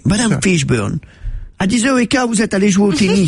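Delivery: put-on Poirot's English accent in French. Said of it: "il a l'accent anglais".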